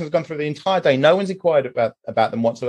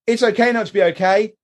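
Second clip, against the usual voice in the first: mock empty slogan, pitched higher; hollow mocking slogan; 6 semitones higher than usual. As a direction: sneer at the hashtag by parroting it higher. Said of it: "But if you're just going".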